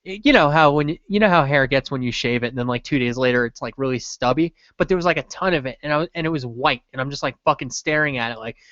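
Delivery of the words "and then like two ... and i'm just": ordinary delivery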